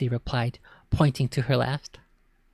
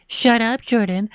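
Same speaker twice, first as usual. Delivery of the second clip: much higher, louder parroting; mocking repeat; 8 semitones higher, 4 dB louder than usual. Brother parroting his sister, mocking high repeat. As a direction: echo her back mockingly. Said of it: "Jordan repeated".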